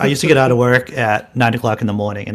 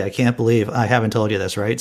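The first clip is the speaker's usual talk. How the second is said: ordinary delivery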